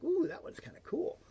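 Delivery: breathy high whisper of delight; soft pleased whisper aside; excited whisper. Real, not acted. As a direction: whisper it like a little secret thrill. Excited aside about Walmart.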